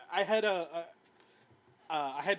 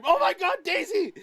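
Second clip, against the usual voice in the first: high, loud fan scream; excited scream; 14 dB louder than usual. Retold or imitated. Reliving spotting Daisy in the trailer, excited scream. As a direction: scream it out in sudden delighted recognition. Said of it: "and I scream".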